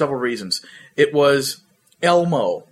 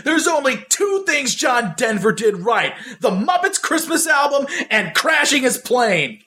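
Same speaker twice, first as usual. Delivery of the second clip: shouted higher and slower; loud angry outburst; 9 semitones higher than usual. Retold or imitated. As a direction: blurt it out loud and pissed off. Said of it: "he said in a really loud voice".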